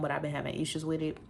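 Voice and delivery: normal talking voice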